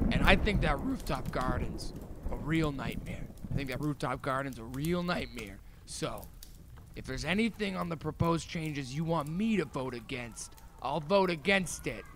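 low, soft, secretive pitch to the fellas; hushed conspiratorial pitch. Retold or imitated. Narrator quietly pitching the fellas a vote deal, hushed. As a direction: lean in and keep it down like a secret deal.

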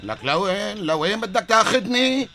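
loud, high, slow frightened question; alarmed protest. Acted. Friend being dragged off by Jamal, loud frightened protest. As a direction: panic as you're pulled along.

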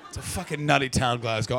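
usual speaking voice

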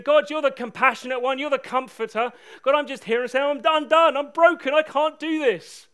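high, loud, desperate plea; calling out. Retold or imitated. Preacher retelling his prayer in the pouring rain, calling out desperately. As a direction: shout it to God, broken and done. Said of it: "calling out to God".

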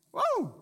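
high, loud "woo"; excited whoop. Real, not acted. Preacher thrilled by riches in heaven, whooping. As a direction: let out a preacher's exultant whoop.

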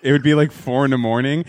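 normal talking voice

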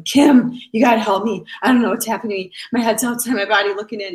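frightened, pleading husband retold by his wife; desperate plea. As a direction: plead for help like a man coming apart. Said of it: "And he's just bawling".

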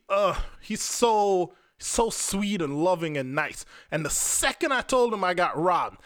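usual speaking voice

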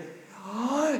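sharp gasp. Acted. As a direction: gasp in shock. Mock commentator reacts to move.

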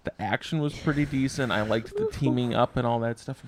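usual speaking voice

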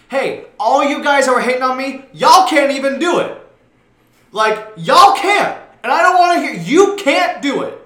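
loud, high-pitched challenge to the haters; worked up. Real, not acted. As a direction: call out your haters, getting heated. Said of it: "I don't wanna get all worked up".

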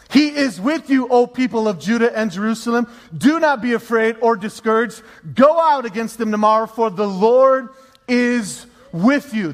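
emphatic scripture proclamation, stretching key words; preaching. Acted. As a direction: declare it boldly to the people. Proclaims scripture at full force.

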